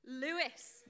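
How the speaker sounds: "Lewis" said as LOO-iss; "Lewis" with an OO in the first syllable; mispronounced name. Shown as a demonstration. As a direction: set "Lewis" apart as the worst version. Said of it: "lots of people like to call me".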